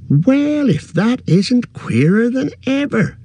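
high, drawn-out farmer's astonishment; astonished rustic exclamation; exclaiming in amazement. A narrator's reading. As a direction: marvel slowly, pitch soaring. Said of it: "he exclaimed".

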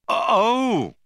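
high, loud startled oh; guilty start. A narrator's reading. Zaphod caught out by Arthur, high guilty startled oh. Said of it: "with a guilty start".

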